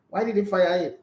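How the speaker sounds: ordinary delivery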